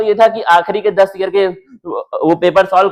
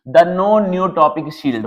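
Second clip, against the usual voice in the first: slow reading aloud; much slower than usual. Shown as a demonstration. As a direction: slowly announce the rule title. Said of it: "देखना क्या है one". (धीरे-धीरे शीर्षक पढ़ना)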